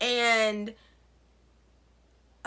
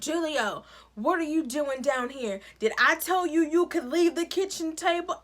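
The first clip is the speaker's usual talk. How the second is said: higher-pitched mock parental scolding; imitated scolding dad; 5 semitones higher than usual. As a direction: tell off a kid like a cross dad. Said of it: "here comes their dad".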